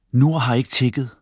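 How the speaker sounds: plain reading voice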